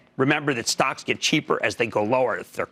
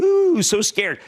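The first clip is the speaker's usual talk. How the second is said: higher, louder hammed-up "ooh, so scared"; mock scared; 7 semitones higher, 4 dB louder, much slower than usual. Mocking bond-market worriers, hammed-up scared voice. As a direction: sneer at the worriers with a fake fright. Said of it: "which everyone's saying".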